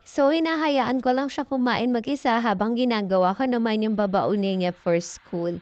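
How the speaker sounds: ordinary talk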